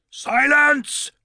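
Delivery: very high sharp shout for silence; teacher shouting down the room. Acted. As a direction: silence the tittering class. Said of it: "shouted Mister Eustace".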